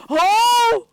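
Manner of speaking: high, loud startled "oh!"; startled yell. Real, not acted. Player reacting to the game, startled yell. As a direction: yelp out loud in shock.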